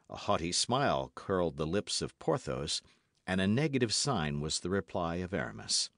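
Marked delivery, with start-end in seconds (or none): none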